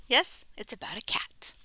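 soft confiding whisper. Pretend. Host whispering the answer to children. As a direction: confirm it like a secret.

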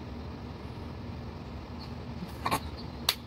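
airless wheezing laugh at her own joke; breathy silent laugh. Real, not acted. Vlogger cracking herself up, silent wheeze laugh. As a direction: shake with a soundless giggle. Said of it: "I cracked myself up".

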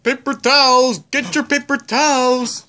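high, loud street hawker cry; vendor calling out. Pretend. Seller shouting to shoppers about paper towels, hawker call. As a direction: sell it to the whole street.

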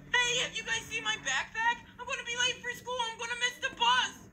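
high-pitched, whiny little-brother voice; fretful kid brother. Acted. Kid brother late for the bus asks his brothers, fretful.

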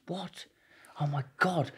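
gasped-out quote, kept very quiet; hushed shock. Retold or imitated. Lawyer hearing witnesses were paid, hushed mock shock.

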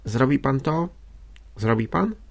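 sweet, anxious question, lifting at the end; sweet pleading. A narrator's reading. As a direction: beg him gently, a touch uneasy. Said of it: "pytała Madzia słodkim głosem, w którym było tyle prośby i niepokoju". (słodko, prosząco)

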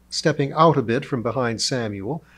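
plain reading voice

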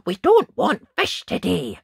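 high, loud dismissal; stubborn refusal. A narrator's reading. Ned turning away the fisherman, loud stubborn refusal. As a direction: call it out insistently. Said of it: "Ned told the fisherman".

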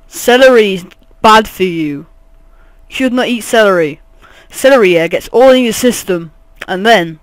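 loud, emphatic lecturing voice; mock rant. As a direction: preach it loudly with punched words. Mock rant against celery.

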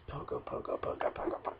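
soft whispered chant; whispering. Real, not acted. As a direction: whisper the name over and over. Muttering the name.

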